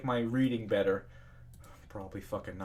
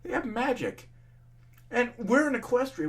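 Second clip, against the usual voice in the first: exasperated rant; 12 semitones higher than usual. Real, not acted. Ranting at commenters.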